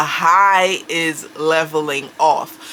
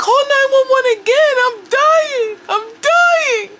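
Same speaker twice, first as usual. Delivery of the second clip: much higher, louder and fast, sobbing panic; panicked crying plea; 5 dB louder than usual. Retold or imitated. Mother urging her daughter to redial, crying in panic. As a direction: shriek it in a rush, terrified. Panicked mom after failed call.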